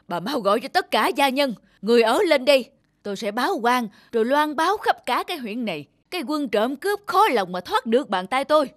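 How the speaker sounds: loud, angry commands to his wife; furious orders. Acted. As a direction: bark orders in a rage. Master furious, orders servants summoned. (giận dữ quát tháo)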